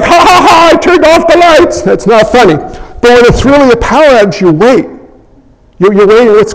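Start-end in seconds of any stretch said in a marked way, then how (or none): 0.0-1.8 teasing prankster. Retold or imitated. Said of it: "He's like".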